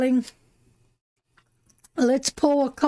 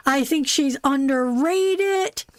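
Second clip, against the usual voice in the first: normal talking voice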